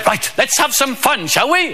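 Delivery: high-pitched, over-eager magician; rally the kids for some fun; mock children's entertainer. Magician warms up the school audience, high and hammed-up.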